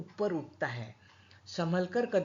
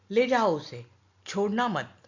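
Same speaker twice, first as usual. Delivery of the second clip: louder, commanding mother; stern order; 3 semitones higher, 7 dB louder than usual. Acted. Mother ordering the children to drag him, loud command. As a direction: bark the order to the kids.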